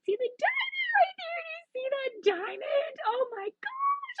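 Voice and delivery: very high, rising into a squeal; can't contain the thrill; excited squeal. Real, not acted. Thrilled over the diamond pattern.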